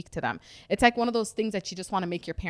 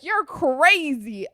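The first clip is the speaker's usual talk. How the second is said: high, loud, stretched-out mock outrage; imitated exasperated cry, slow; mom freaking out; 7 semitones higher, 5 dB louder, much slower than usual. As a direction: drag out "crazy" like an appalled mom. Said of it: "she was freaking out".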